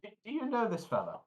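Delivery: high, puzzled question; bemused halfling. Acted. Halfling asks the dwarf about the stranger, high and baffled.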